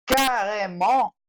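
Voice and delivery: Carrément said slowly with a throaty R and nasal end. Shown as a demonstration. Teacher modelling for beginners: slow carrément. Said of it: "Eu gosto de explicar assim".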